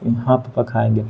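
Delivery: put-on soft, small voice of a beaten kid; barely answer, cowed; a cowed son agreeing to eat samosas, soft and meek; meek reply. Beaten son answers father meekly.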